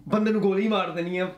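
lightly acted aggressive film hero; dangerous Punjabi film tough. Shown as a demonstration. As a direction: throw out a mock-dangerous Punjabi movie line. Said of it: "feels dangerous".

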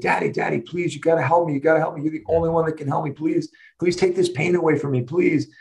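high, urgent imitation of a pleading child; retold crying out. Daughter begging dad to take pain away, imitated desperate cry. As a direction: beg over and over, voicing a child in pain. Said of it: "she was crying out to me".

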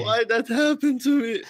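high, hammed-up lament; mock wail. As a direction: wail it as a joking complaint.

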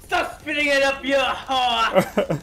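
put-on loud French-accented scolding. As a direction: yell it as an indignant Frenchman. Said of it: "say it in your French accent, too".

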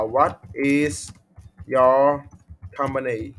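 word by word, pausing between chunks; slow dictation. Shown as a demonstration. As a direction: spell out the question slowly for a learner to copy. Teacher dictates a sample question.

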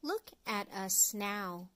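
plain reading voice

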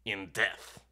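loud, triumphant imp. Acted. Imp announcing the rooms to players, triumphant and loud. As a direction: announce it like a gleeful demon.

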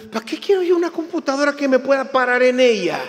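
fast, loud, high scoff; incredulous retort. Retold or imitated. Preacher retelling his scoffing reply to the salesman. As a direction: scoff at the absurd offer.

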